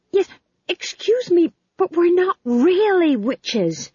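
high, loud, drawn-out objection; high pleading protest. Acted. Prisoner telling the guards they're not really witches, high pleading protest.

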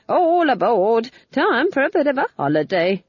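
ghosts' cheer. Retold or imitated. Nanny imitating ghosts eager to leave, jolly. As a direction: play the ghosts hamming it up. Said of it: "they all thinks".

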